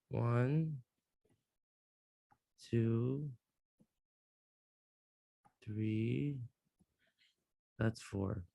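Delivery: very soft, low self-talk; absorbed, barely voiced murmur; muttering while drawing. Real, not acted. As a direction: count under your breath as you draw.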